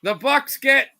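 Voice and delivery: energetic basketball call; broadcast-style play-by-play; live play-by-play. Acted. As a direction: ride the action as it happens.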